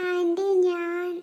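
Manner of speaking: very slow, drawn-out words; halting confession. Acted. Boy about to confess to aunt, halting. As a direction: stall as you work up to confessing.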